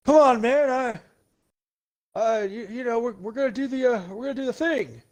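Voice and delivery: put-on high, halting old-man Biden voice; Biden impression. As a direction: fumble for words like a confused old president. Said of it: "Joe Biden would be like".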